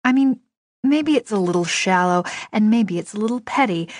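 ordinary delivery, nothing marked

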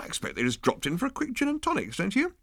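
easygoing captain teasing his officer; airy captain. Acted. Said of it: "said the captain".

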